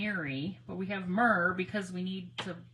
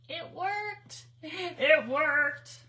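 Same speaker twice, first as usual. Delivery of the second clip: building from amazed to loud joy; excited celebration after success; 8 semitones higher, 6 dB louder, much slower than usual. Real, not acted. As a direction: let the joy burst out. Rising celebration.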